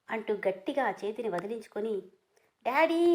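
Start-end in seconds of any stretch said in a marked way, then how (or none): 2.6-3.0 loud call. Acted. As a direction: yell Daddy high and loud. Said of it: "అని గట్టిగా అరవగానే". (తండ్రిని పిలుస్తూ గట్టిగా అరుపు)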